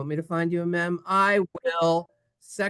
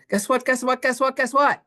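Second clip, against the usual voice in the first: higher, louder, building eager guess what; excited teasing; 9 semitones higher, 5 dB louder than usual. Real, not acted. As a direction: tease the class with rising excitement. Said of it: "teacher teasing class".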